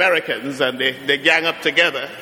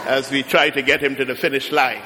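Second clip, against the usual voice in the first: ordinary talk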